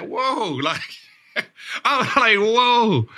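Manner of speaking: high stunned whoa with a laugh. Retold or imitated. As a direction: replay the shock of hearing the news. Retelling reaction to trade news.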